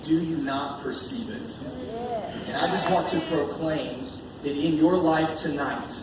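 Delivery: ordinary talk, nothing marked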